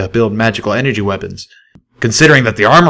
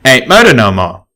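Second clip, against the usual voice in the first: firm statement; 4 semitones higher, 6 dB louder than usual. Acted. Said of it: "stated without reservation".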